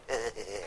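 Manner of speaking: low, quiet sly chuckle; mock wicked snicker under the breath. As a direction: imitate a scheming little giggle. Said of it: "she got this wicked grin".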